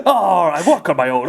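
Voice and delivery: exaggerated copy of a co-host's impression; hammed-up lone-wolf line; Gerard Butler. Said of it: "is that what Jared Butler sounds like".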